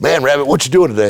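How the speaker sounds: high, fast and loud, imitating an upbeat fan; mock cheerful hello from a customer; cheery fan greeting. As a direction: toss it off like a buddy dropping by.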